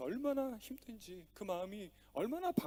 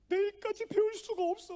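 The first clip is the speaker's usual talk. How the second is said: put-on crying little girl; 5 dB louder than usual. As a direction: whine it like a sobbing daughter. Crying daughter speaks to father.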